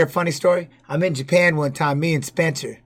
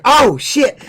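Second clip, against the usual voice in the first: higher-pitched "oh" of dawning alarm; retold realization; 6 semitones higher, 4 dB louder than usual. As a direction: blurt it as the penny drops.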